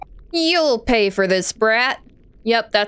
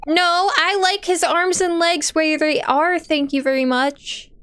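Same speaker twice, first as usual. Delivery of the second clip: sassy captive; 6 semitones higher than usual. Acted. Captive talks back to captors.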